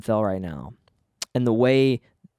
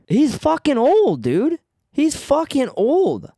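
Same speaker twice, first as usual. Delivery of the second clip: emphatic outburst; 13 semitones higher, 5 dB louder, slower than usual. Real, not acted. Ranting to listeners that Biden is old, loud exasperated outburst.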